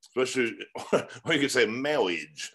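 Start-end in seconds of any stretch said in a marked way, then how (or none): 1.7-2.5 MARRIAGE said slowly as "mawwiage"; comic lisped "mawwiage" for MARRIAGE; Princess Bride marriage. Retold or imitated. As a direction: draw out MARRIAGE with w for the r. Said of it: "like in the, uh, Princess Bride".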